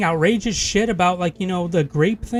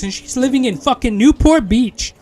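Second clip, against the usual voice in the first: louder and higher, indignant over Newport Beach; heated rant; 4 semitones higher, 6 dB louder than usual. Real, not acted. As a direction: hammer the place name in outrage. Angry rant about coworker's building.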